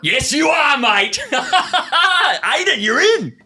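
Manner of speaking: high, loud laughing welcome; excited cheer. Real, not acted. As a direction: greet him like a mate who nailed it. Second caller answers correctly.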